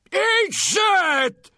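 furious yell behind a door; from another room. Acted. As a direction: bellow it from inside a closed room. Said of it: "yelled the sheep professor from inside".

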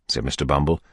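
ordinary delivery, nothing marked

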